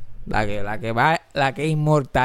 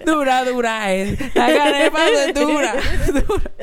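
high-pitched mock performance of the band; imitating the band; 7 semitones higher than usual. Podcaster mimicking the band in their video, high mock performance. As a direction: chant it high, each phrase falling. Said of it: "Y después un video de ellos".

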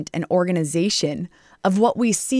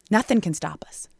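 ordinary talk, nothing marked

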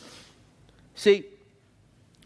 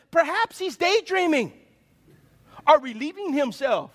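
high, teasing jibe breaking into a laugh; mocking; 6 semitones higher than usual. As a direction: poke fun at their absent god. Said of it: "Elijah began mocking them".

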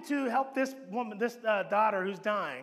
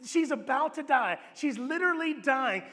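usual speaking voice

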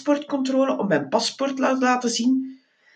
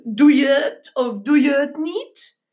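dozy rodent driver; 4 semitones higher than usual. Acted. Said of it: "Hij vroeg".

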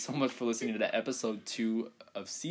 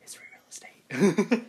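slow whispered meme line; 8 semitones higher, 9 dB louder, slower than usual. Retold or imitated. Quoting the video's line to friends, slow whisper. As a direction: breathe it out slowly in a whisper. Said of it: "he's like".

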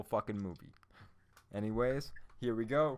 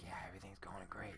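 unvoiced whisper, much softer; mock whispered lie; whispered reply; 4 semitones lower, 14 dB quieter than usual. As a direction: hush it as if hiding the truth. Stiller answers the phone call.

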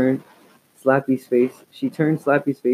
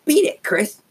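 high, harsh dummy snarl; harsh raspy snarl. A narrator's reading. Dummy snarling at Chris, harsh and raspy. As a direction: snarl it like a nasty puppet. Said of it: "he snarled in a harsh, raspy voice".

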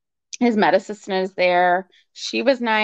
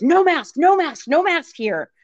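a hammed-up bossy doctor laying down the rule; mock aggressive doctor; 7 semitones higher than usual. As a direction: bark the order like an overbearing doctor. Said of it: "And he's very aggressive".

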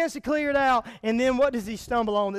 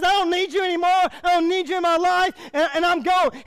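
angry son leaving; 8 semitones higher, 6 dB louder than usual. Acted. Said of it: "He says".

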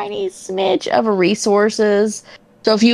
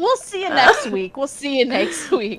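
laughing; 5 semitones higher than usual. Real, not acted. Sign-off while laughing.

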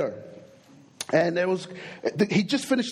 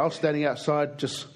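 usual speaking voice

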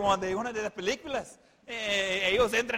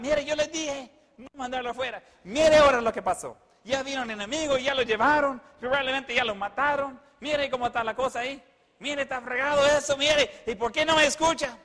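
high, insistent wifely nagging; mock scolding; 4 semitones higher, 3 dB louder than usual. Sara telling Abraham off over Lot, mock scolding. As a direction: pile up complaints in a raised voice.

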